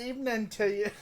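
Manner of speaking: a playful stab at Cockney, pitched high; hammed-up Cockney. Table read, actor greets Poe in Cockney. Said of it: "I can try to do a good Cockney".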